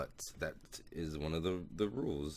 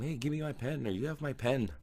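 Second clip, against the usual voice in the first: mock yell; 11 semitones higher than usual. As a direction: call out in a high, mock-indignant retelling. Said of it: "so he yells at him".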